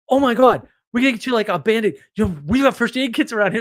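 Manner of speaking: hammed-up flustered organizer; mock panic. Caroline fussing over the injured guy, mock panic. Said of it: "flips out in the other direction".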